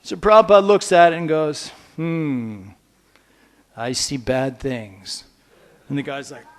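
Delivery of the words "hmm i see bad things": low, slow, grave pronouncement as the teacher; grave palm reading. Acted. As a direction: let the words drop low and slow, like bad news. Prabhupada reads a palm.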